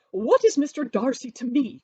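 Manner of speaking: high, loud, defiant matron; indignant Mrs Bennet crying out. A narrator's reading. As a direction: cry out defiantly, not caring who hears. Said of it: "cried Missus Bennet".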